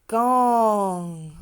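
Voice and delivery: Gong with a ringing ng at the end; ng sound. Shown as a demonstration. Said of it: "Remember the N G is ng".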